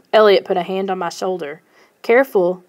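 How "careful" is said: ordinary delivery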